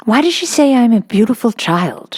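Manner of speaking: put-on a little girl wondering to herself; young girl's earnest inner voice; Sarah.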